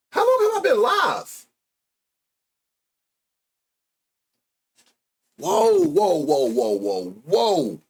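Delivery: can't believe what's happening; astonished. Real, not acted. Streamer overwhelmed by chat gifts, high astonished whoa. Shocked by flood of donations.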